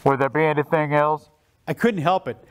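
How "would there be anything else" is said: low, deadpan intercom voice; drive-through order taker. Retold or imitated. Worker returns to the speaker, low composed order-taker voice. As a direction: ask it lower, keeping a straight face. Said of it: "he comes back on and says".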